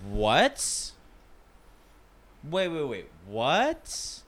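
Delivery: high, disbelieving what; can't believe what you're hearing; shocked disbelief. Real, not acted. Friend never had Thai food.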